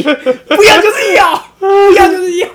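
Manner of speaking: high, loud, slow shout through laughter; laughing mock shout. Boyfriend taking her no as a yes, mock shout with laughter. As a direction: bellow it like a boyfriend who won't stop.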